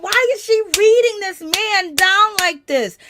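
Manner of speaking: loud, high-pitched disbelief; incredulous outburst. Retold or imitated. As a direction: exclaim it loudly, scandalized by the shade. Reacting incredulously to shade.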